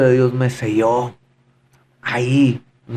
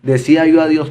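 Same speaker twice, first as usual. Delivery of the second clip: ordinary delivery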